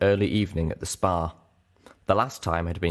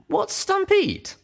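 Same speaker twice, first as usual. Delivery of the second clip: curious question; 4 dB louder, slower than usual. Acted. Narrator asks curious question.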